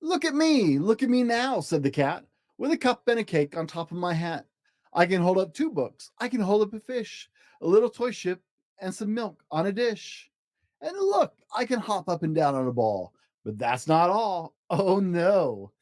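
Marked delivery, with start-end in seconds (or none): 0.0-1.6 showing-off cat. Acted. Cat balancing on a ball, high gleeful boast. 2.6-15.7 higher, showing off; boastful cat. Acted. Cat boasting to the fish, higher and proud.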